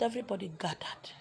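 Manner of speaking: everybody gathered whispered. Real, not acted. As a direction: drop to a hushed whisper. Narrating quietly.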